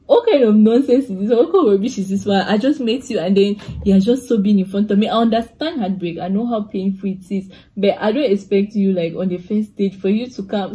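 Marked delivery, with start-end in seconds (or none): 0.1-5.1 loud telling-off, as voiced in her head; mock indignant outburst. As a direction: rant in exasperated disbelief. Her silent outburst at the date.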